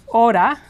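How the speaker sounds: hora said o-da, tapped R, rising; hora, no H, quick tap; silent H. Shown as a demonstration. Teacher demonstrating hora for students. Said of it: "represented in the pronunciation guide as".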